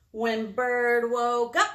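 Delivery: loud, slow, stretched-out narration; big storytime opener for little listeners; dramatic story opening. A narrator's reading. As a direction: draw out each word to open the tale. Dramatic opening of picture book.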